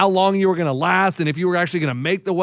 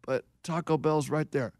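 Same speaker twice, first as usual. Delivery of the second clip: low, much softer plea; drunken mumble; 4 semitones lower, 11 dB quieter than usual. Retold or imitated. Ray begging his wife for Taco Bell, soft mumbled plea.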